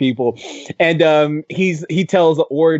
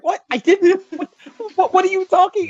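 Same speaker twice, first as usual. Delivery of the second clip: much higher, jumbled mock confusion; flustered squeak; 14 semitones higher than usual. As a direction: play it as a hammed-up flustered stammer, high. Chickenfoot quoted, flustered and confused.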